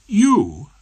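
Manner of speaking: high, loud disbelieving "You?"; lightly voiced surprise; young barrister doubting Rumpole; incredulous. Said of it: "She looked incredulous".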